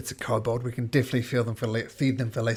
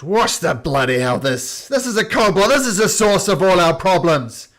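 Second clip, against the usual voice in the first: loud, higher, outraged town speaker; angry speaker; 7 semitones higher, 12 dB louder than usual. Acted. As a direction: bellow it like an outraged elder. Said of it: "He goes".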